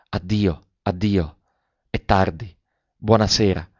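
ordinary delivery, nothing marked

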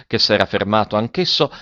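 ordinary delivery, nothing marked

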